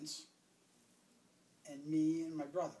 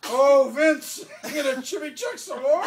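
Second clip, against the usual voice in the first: very loud, high holler breaking into laughter; mock-threatening shout; imitated exasperated yell; 8 semitones higher, 17 dB louder than usual. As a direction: holler at Vince as grandfather. Grandfather hollers at Vince again.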